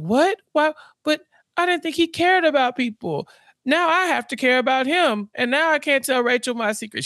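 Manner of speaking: high, dismayed whine; hammed-up aggrieved complaint; mock pity party. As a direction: protest up high, feeling sorry for yourself. [name]'s pity party goes on.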